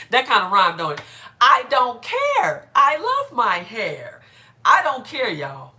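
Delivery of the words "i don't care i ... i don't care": loud, high, drawn-out declaration; defiant rhyming retort. Acted. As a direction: proclaim it loudly, stretching the rhyme. Repeats her rhyming defiant retort.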